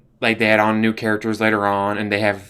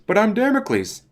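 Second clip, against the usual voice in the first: high squeaky protest; actor's protest; 11 semitones higher than usual. Retold or imitated. Actor protesting to the company, high and squeaky. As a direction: whine it like a hurt actor. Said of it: "as the T V production company pointed out".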